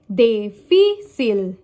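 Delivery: difícil slowly, syllable by syllable, strong FÍ; stress on accented syllable. Shown as a demonstration. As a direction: punch FÍ: di-FÍ-cil. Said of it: "written accent on the syllable that is stressed".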